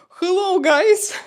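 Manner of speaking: high, loud, slow hammed-up accent; mock Hollywood English. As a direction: ham up a clumsy movie line. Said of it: "imagine my English, me playing in Hollywood movie".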